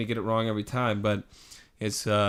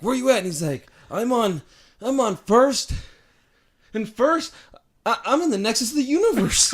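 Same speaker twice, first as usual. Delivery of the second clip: high, loud, excited Kramer voice; Kramer impression; 10 semitones higher, 5 dB louder than usual. Retelling Seinfeld to a friend, hyper Kramer voice. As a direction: pitch it up like a frantic Kramer.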